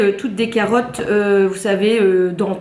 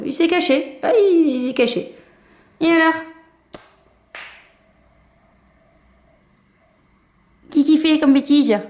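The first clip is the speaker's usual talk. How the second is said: talking to a pet; 5 semitones higher than usual. Real, not acted. Talks to the cat.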